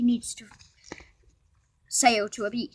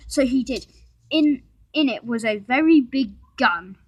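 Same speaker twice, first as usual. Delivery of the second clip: ordinary delivery, nothing marked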